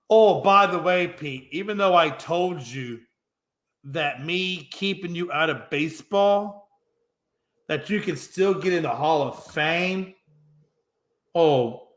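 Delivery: ordinary talk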